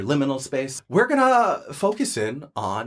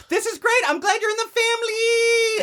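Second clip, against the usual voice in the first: much higher, sugary-sweet; sweet squeaky voice. Acted. Cat Caldonia sweetly welcomes Terry after a threat. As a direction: switch to syrupy cheer after the threat.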